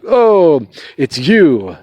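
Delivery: high, loud "oh" easing down; mock ex-wife. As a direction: imitate a rescued ex-wife's greeting, hammed up. Voices rescued ex-wife's greeting.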